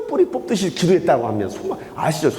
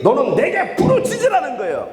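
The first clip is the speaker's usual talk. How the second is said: crying out; 6 semitones higher than usual. Shown as a demonstration. Preacher urging the congregation to cry out to God, impassioned shout.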